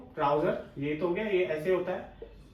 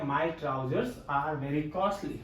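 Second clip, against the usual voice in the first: slow dictation; 4 semitones lower, 6 dB quieter, much slower than usual. Shown as a demonstration. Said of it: "तो यहाँ पे is नहीं आएगा बल्कि क्या आएगा".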